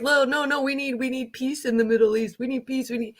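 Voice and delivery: mock the guy begging for peace, half-hearted; sarcastic parody of his no no no, we need peace; mocking imitation. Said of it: "They're all like".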